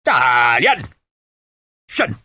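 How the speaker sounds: BATTALION drawn out, then a sharp SHIN; sergeant's drill command; parade-ground bellow. A narrator's reading. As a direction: call troops to attention. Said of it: "His voice was loud enough to make the closest men wince".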